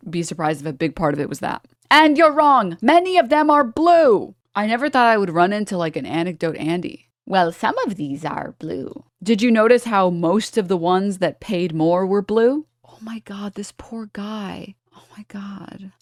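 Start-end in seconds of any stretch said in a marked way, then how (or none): 1.9-4.3 loud, high-pitched mock of an indignant commenter; mocking chatter voice. 7.3-9.1 slow, lightly put-on commenter voice; a hint of a mock know-it-all; mocking chatter imitation. Mimics the anecdote-arguing chatter. 12.8-15.9 very soft, near-whispered disbelief; quiet, half-whispered dismay; hushed pitying aside. Real, not acted. Pitying aside about the chatter.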